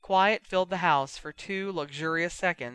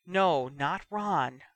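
ordinary delivery, nothing marked

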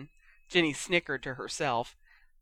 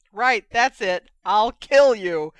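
loud, raised threat; furious yell; 4 semitones higher, 9 dB louder, slower than usual. A narrator's reading. Ron at his teasing siblings, furious yell. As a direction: yell it at your tormentors. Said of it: "Ron yelled".

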